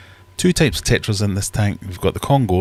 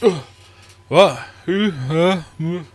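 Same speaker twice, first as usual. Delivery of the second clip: higher, louder dazed grunts, a hint of being woken; groggy fish; 11 semitones higher, 5 dB louder, slower than usual. Discus woken in the night, higher, louder and dazed. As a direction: voice a sleepy fish jolted awake. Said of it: "just like".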